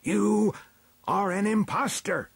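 high, loud, imperious elder; crying out an accusation. A narrator's reading. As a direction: denounce the stranger from the throne. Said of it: "cried the chief elder".

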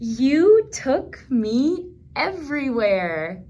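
slow, tender storybook reading; reading to children. Acted. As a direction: read each line slowly to little listeners. Reading picture book text.

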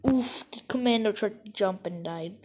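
usual speaking voice

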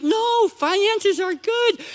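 high, slow, strained fake calm; forced reassurance. Parent tells the kids all is fine, forced reassurance. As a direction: reassure them while barely holding it together. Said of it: "You have to tell your kids".

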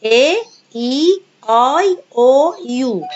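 A E آي أو يو drawn out, each vowel sung out slowly; vowel drill. Shown as a demonstration. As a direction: chant A E آي أو يو slowly for pupils to repeat. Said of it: "Repeat after me".

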